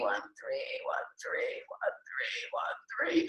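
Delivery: digits trail off into a whisper; fading whisper. Shown as a demonstration. Teacher showing digits go on forever, whispering. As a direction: let the numbers vanish in a hush.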